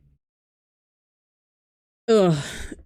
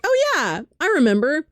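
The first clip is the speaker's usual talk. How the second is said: friend's voice, high; 7 semitones higher than usual. Retold or imitated. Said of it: "he was like".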